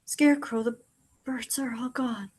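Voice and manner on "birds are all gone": softer, breathless whisper; faint whisper. Acted. Dying Crow telling Scarecrow, faint whisper. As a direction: let the words slip out as a whisper.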